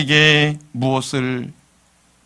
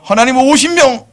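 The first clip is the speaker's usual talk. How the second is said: eager bargaining; 10 semitones higher, 7 dB louder than usual. Acted. Preacher acts Abraham bargaining with God.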